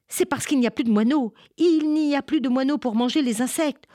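high, loud, fast outburst; blurting out what she can no longer hold in; bursting out. Acted. Minli bursting out to the farmers, high and fast. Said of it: "Elle ne put se retenir".

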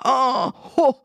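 high, loud cry of horror; horrified cry. Acted. Witch opening the cupboard, crying out in horror. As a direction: wail at the disaster. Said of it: "cria-t-elle".

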